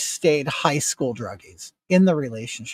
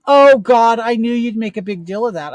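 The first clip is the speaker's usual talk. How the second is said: imitating a fed-up wife, loud higher "Oh God"; exasperated retort; 5 semitones higher, 6 dB louder than usual. Wife accused of making a big deal, retold exasperation. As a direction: burst out exasperated, then ease off while staying higher. Retold quarrel, wife's annoyed reply.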